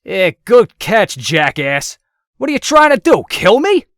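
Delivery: loud, high furious yelling; angry shout. A narrator's reading. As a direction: berate him for dropping you. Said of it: "the man said".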